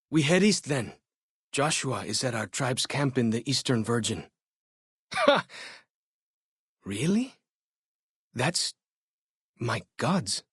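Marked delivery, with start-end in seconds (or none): 5.1-5.5 a sharp high burst of laughter; sudden laugh. Acted. Scout laughing at the traveler's story, a sudden high laugh. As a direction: bark out one surprised laugh.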